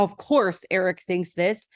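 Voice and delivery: ordinary delivery, nothing marked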